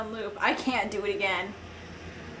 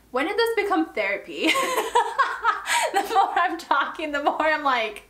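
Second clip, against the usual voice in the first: laughing; 7 semitones higher than usual. Real, not acted. Laughing at her own oversharing.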